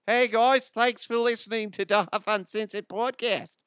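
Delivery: high-pitched, hammed-up Australian accent. Host improvising a thank-you to Australian listeners, mock Australian accent. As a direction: put on a loud, silly Aussie voice. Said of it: "I didn't do Australian".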